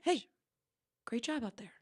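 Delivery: whispered praise; hushed backstage whisper. Acted. Whispered praise in mock backstage scene.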